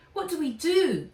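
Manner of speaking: high, loud frightened question; panicked question. A narrator's reading. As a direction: ask in a panic. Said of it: "she said, leaping to her feet".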